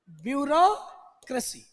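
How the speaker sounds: Bureaucracy split slowly into bureau, pause, cracy; syllable by syllable. Shown as a demonstration. Teacher prompting students to repeat, slowly in two parts. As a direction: stretch bureau with a lift, then drop on cracy.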